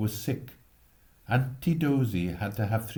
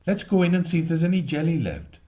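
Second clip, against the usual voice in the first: put-on higher, faster and louder, a cheerful boy; eager boy; 7 semitones higher, 4 dB louder, faster than usual. Boy urging his friends in for jelly, quick and cheerful. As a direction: rush it out, glad to be home. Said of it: "Jack said".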